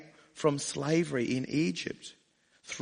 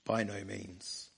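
softer, lower, slow repeat of "By no means"; quiet echo; 6 semitones lower, 10 dB quieter, slower than usual. Shown as a demonstration. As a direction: let "By no means" sink in softly. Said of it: "his emphatic no".

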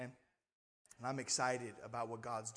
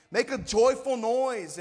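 loud, high, drawn-out preacher's call; rally the congregation at full voice; ringing, slow shout of praise; rousing exhortation; 9 semitones higher, 12 dB louder than usual. Real, not acted.

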